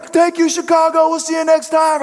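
very high, loud stage shout; mock rock-star farewell; rock musician's sign-off. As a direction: holler goodnight to a huge concert crowd. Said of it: "he would work the crowd over".

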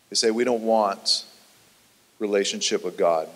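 low, soft, sober delivery; hushed, solemn preaching. Real, not acted. Preacher recounting Job's losses to listeners, hushed and solemn. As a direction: drop into a low, weighty hush.

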